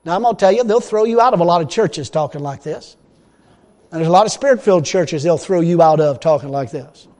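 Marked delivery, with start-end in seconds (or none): none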